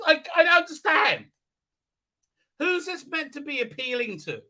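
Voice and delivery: high, pleading disbelief; real exasperated complaint; exasperated bafflement. Real, not acted. Reviewer baffled by the show.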